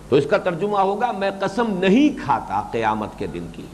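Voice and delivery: ordinary talk, nothing marked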